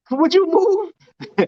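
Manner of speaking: slow, high laughing plea. Real, not acted. Laughing as he tells a friend to move. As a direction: laugh it out while shooing them off.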